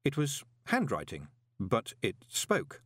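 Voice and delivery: ordinary delivery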